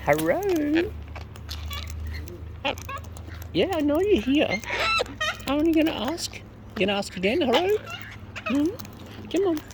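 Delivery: high, sing-song pet voice throughout; high cooing pet talk to the birds; bird baby talk. Acted. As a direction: coo at the lorikeets like at a baby.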